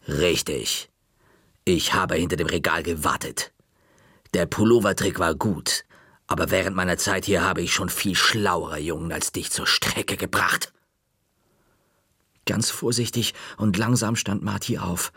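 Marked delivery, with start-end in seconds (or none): 0.1-10.7 low, cold, triumphant librarian; stern librarian. Acted. The librarian tells the boy she outsmarted him, low and smug. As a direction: savour the victory, low and cool.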